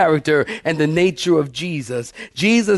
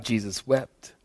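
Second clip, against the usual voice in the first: hushed; 6 semitones lower, 9 dB quieter than usual. Acted. Preacher repeats verse, hushed.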